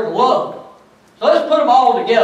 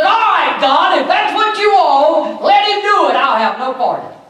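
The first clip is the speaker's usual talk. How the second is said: high, loud sulky shout; hammed-up pouting David; mock sulking David; 7 semitones higher, 3 dB louder than usual. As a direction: ham up a pouting king.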